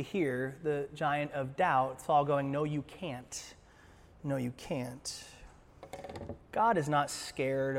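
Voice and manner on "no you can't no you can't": soft, slow, low discouragement; hushed naysayer; quiet doubting. Acted. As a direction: murmur it slowly, dragging the words. Saul's doubt, acted softly.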